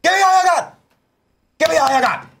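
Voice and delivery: very high, loud shout; Al Pacino yell. Retold or imitated. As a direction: scream it like Pacino. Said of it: "Al Pacino ... off the rails".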